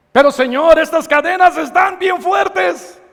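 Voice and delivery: high, strained pleading voice, pitch up; pleading sinner. Acted. Sinner begging the Lord, cadenas too strong: high strained plea. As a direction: cry out to the Lord as a desperate sinner.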